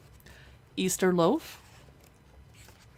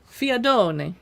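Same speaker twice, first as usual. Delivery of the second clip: Italian accent; 2 semitones higher, 6 dB louder than usual. Shown as a demonstration. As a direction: say Fiadone the Italian way, louder. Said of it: "I said that with an Italian accent".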